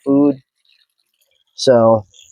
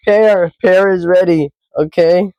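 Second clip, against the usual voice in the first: high playful voice; 5 semitones higher than usual. Acted.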